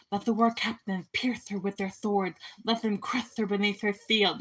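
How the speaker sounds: lisping voice. Acted. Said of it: "a lisping voice".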